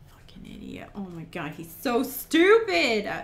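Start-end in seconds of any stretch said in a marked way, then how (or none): 0.1-0.9 whispered insult, barely voiced; voiceless muttered curse; under-breath whisper. Real, not acted. 1.5-3.1 loud, slow exasperated cry; drawn-out frustrated yell; frustrated shout. Real, not acted. Outburst at the character.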